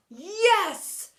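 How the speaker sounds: high, loud triumphant yes. Acted. Teacher celebrating with the class, triumphant. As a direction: punch the air as you say it. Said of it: "replied Mister Phoebe, punching the air".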